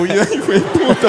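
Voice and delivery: high, laughing curse; cursing the thief while laughing; mock curse. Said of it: "Y yo".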